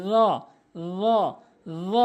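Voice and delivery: "র" said as heavy voiced th, no r; tongue tip to teeth edges, buzz "র" as dho; correct pronunciation. Shown as a demonstration. Teacher models the Arabic letter, "র" as buzzing th.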